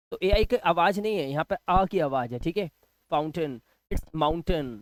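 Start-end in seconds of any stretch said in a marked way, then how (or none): 3.1-4.8 फाउंटेन and mountain with reduced endings; correct pronunciation. Shown as a demonstration. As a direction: model both words ending in "tun". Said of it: "हम इसे माउंटेन बोल देते हैं".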